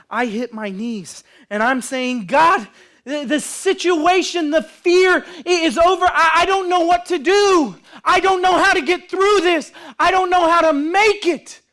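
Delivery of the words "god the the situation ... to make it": high, loud cry to God; acted anguished outpouring in prayer; desperate prayer. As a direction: plead at full voice, overwhelmed.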